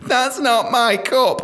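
high, loud, drawn-out mock whinge; mock complaining. Colleague complaining to new worker over a tea cup, mock whiny. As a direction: stretch it into a slow, high moan. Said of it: "she complained".

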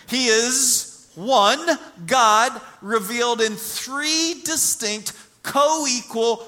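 loud, high preaching on the Trinity; full-force preaching. Acted. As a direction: preach it out to the congregation.

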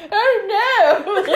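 high, loud mock cry of horror; hammed-up horrified yelp; panicked reenactment. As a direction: act out being caught, shrill and loud. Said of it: "the reenactment there".

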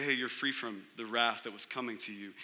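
soft, quiet, kind pardon; gentle release. Retold or imitated. Judge freeing the guilty defendant, quiet and kind. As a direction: let them go softly, almost hushed. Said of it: "Not only does he say".

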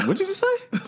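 high shocked dad. Retold or imitated. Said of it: "I said".

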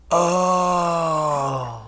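long, drawn-out understanding oh; mock realization. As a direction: imitate the penny slowly dropping. Imitates people's dawning understanding.

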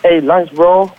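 casual Malaysian English accent; Manglish. Shown as a demonstration. Manglish take for the director's audition. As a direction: toss it off in Manglish slang. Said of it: "let's hear the Manglish version of that".

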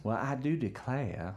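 genteel Georgia drawl, slow; lightly voiced Southern belle; Southern belle accent. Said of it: "Like that kind of thing".

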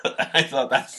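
trying to talk through giggles; still laughing. Real, not acted. Host recovering from laughter over the book, laughing.

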